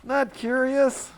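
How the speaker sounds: high, loud objection; loudly querying the script; mock-indignant protest. Real, not acted.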